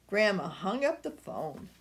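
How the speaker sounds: ordinary delivery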